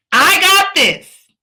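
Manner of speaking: loud, high, slow rallying cry; emphatic shouted affirmation; really meaning it. Shown as a demonstration. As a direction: belt it out like you truly mean it. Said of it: "say it like you really mean it".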